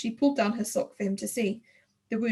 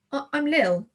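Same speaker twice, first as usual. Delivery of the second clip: stuttering; 5 semitones higher, 5 dB louder than usual. Acted. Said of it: "I stuttered".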